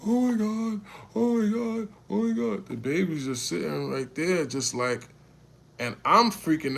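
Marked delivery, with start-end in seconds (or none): 0.0-2.7 high, loud panicked wails; mimicking hysterical mom. Retelling how the crash mom panicked, high wailing. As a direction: imitate a crying mother losing it. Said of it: "just crying, like".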